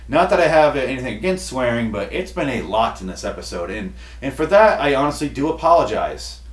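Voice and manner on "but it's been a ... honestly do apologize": usual speaking voice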